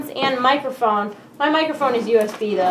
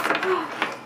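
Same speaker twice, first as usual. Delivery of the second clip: soft gasp; 4 semitones higher, 8 dB quieter than usual. Real, not acted. Gasps at plugs.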